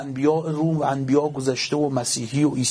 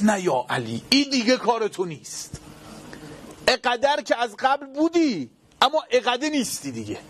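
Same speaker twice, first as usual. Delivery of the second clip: higher, scornful taunt; sneering villain; 9 semitones higher than usual. Acted. Preacher voicing the villain's taunt, sneering high. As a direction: taunt him that his power is gone. Villain voiced mocking Ali.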